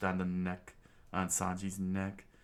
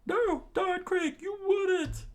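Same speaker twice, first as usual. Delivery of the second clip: very high, louder, slow cartoon cry; high squealing pirates; 7 dB louder, slower than usual. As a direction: shriek like a panicked pirate crew. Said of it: "the pirates screamed".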